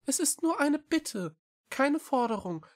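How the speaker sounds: high-pitched small cat voice; pleading little cat. A narrator's reading. (bittende, hohe Katerstimme)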